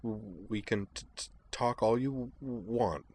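soft, halting stammer on first sounds; a gentle nervous stammer; stammering girl. Acted. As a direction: stumble shyly over each start. Kate's stammering dialogue.

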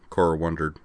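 ordinary delivery, nothing marked

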